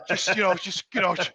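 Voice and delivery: low, soft, placating voice; quiet, hesitant reassurance, acted; retold calming tone. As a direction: imitate someone gently settling you down. Voicing the kind helper calming him.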